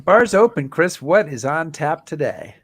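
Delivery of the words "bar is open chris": loud, high show opener; excited announcer. Acted. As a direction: belt out the opening line. Cohost echoes opening line loudly.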